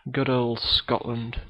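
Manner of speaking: ordinary talk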